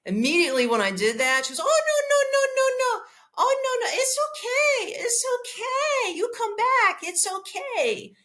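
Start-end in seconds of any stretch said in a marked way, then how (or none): 1.6-8.1 much higher mock-pleading voice for the driver; imitating the driver. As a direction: squeak out her frantic backpedal. Driver's panicked plea, voiced.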